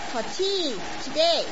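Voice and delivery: put-on mild foreign-accented mother-in-law; broken English. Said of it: "with her broken English".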